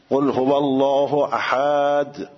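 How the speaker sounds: قل هو الله احد drawn out very slowly, الله stretched; recited with long madd. Retold or imitated. Student imitating the teacher's slow Quran recitation.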